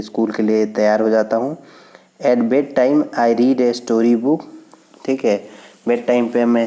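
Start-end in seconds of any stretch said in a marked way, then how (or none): 2.3-4.4 slow, careful classroom reading; slow read-aloud. Shown as a demonstration. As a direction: read it out word by word. Reads text slowly. (धीमी गति से पाठ पढ़ना)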